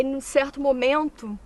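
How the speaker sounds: normal talking voice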